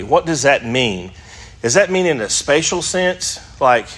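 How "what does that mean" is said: ordinary delivery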